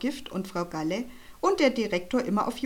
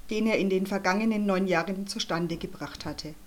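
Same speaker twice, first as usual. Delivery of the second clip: ordinary delivery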